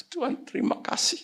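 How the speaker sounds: high, soft, choked thanks; retold tearful prayer. Preacher retelling his prayer to God, high and tearful. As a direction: thank God as if moved to tears. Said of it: "saya nangis".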